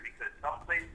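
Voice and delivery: ordinary talk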